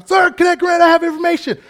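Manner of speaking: high, loud report at attention; cadet shouting report. Retold or imitated. Flustered cadet reporting to staff, loud high bellow. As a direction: bellow it at full force.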